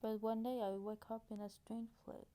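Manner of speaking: English sentence in a French accent. Shown as a demonstration. Vlogger checking her English for mistakes, French accent. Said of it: "observez mon bel accent anglais".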